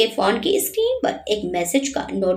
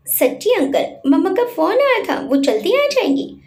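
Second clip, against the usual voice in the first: put-on light up with childish joy; happy excited child; 8 semitones higher than usual. A narrator's reading. The boy hears mummy called, happily excited. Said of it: "युवराज खुश होकर बोला".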